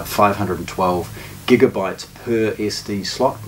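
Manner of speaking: usual speaking voice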